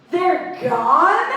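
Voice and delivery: high, amused disbelief; incredulous. Retold or imitated. Comic reacting to news of forgiven loans, incredulous. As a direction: blurt it as a stunned question. Retold shock at loans being erased.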